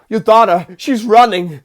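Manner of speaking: loud, high panicked psychic; Christopher Walken. Retold or imitated. Acting out Walken's psychic warning, urgent and loud. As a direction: cry out the vision in mock panic. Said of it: "He's like".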